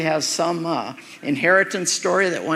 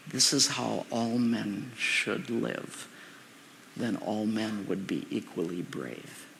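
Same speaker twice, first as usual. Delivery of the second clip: slow, hushed and much softer, each word laid down; slow hushed conviction; 8 semitones lower, 11 dB quieter, slower than usual. Retold or imitated. Jackson to his captain on the battlefield, slow and hushed. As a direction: speak it like a calm creed under fire.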